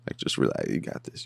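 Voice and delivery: soft unvoiced you got this; coaching her under your breath; whispered encouragement. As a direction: whisper it like a secret pep talk.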